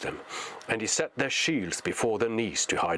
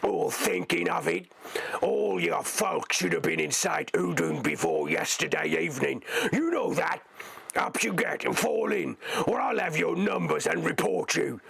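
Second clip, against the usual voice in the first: much higher bullying orc voice; menacing orc; 8 semitones higher than usual. Acted. Orc driver scolds the deserters, high bullying orc. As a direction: threaten with report and whip.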